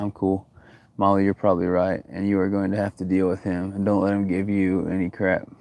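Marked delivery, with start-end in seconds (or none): none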